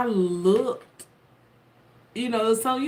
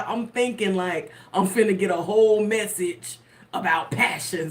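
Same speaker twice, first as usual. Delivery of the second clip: ordinary talk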